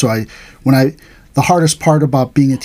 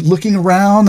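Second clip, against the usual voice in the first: ordinary delivery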